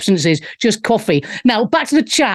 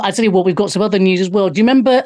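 ordinary talk

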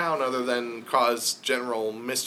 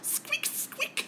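tiny high whispered squeaks; very high, soft, breathless squeak-squeak; hamster squeak; 7 dB quieter than usual. Acted.

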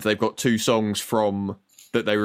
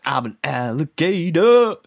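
slow, high, loud mock [name] line; hammed-up [name] impression; imitating [name]; 6 semitones higher, 5 dB louder, slower than usual. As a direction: belt it like [name] opening the song. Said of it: "[name] shouting".

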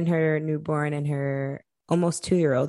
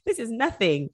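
high, laughing brush-off; laughing dismissal; 6 semitones higher than usual. Retold or imitated. Cousin laughing at her, high and amused.

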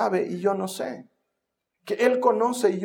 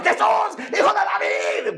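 frantic shouting; 13 semitones higher than usual. Retold or imitated. Blind beggar calling to Jesus as he passes, frantic shout. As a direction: yell out wildly for attention. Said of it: "empieza a gritar como desaforado".

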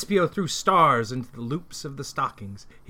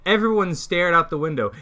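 ordinary delivery, nothing marked

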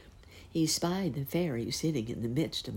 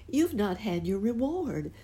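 high eager fairy voice; a small bright fairy insisting; tiny fairy voice; 7 semitones higher than usual. Acted. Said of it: "said the fairy".

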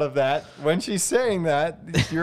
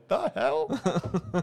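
amused mock bewilderment; 4 semitones higher, 5 dB quieter than usual. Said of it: "Like when something happens and they".

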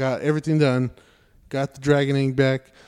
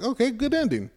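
high, sarcastic "good ending"; mock approval; 10 semitones higher than usual. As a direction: pretend to be pleased with the ending. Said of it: "I'm like".

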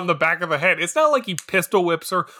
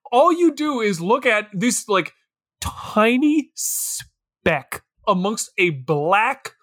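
ordinary talk, nothing marked

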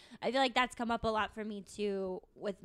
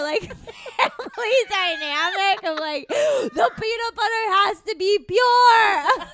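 shouting through laughter; laughing shout; 8 semitones higher, 12 dB louder than usual. Real, not acted. Cracking up at her friend's peanut butter story, loud laughing shout. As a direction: laugh it out loud, barely holding together.

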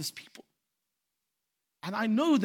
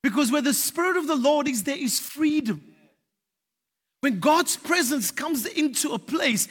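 usual speaking voice

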